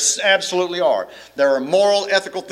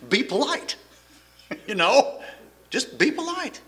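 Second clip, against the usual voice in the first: higher, softer words through laughter; amused chuckling; 9 semitones higher, 3 dB quieter than usual. Real, not acted. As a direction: chuckle while pleading for simple manners. Amused at his own remark.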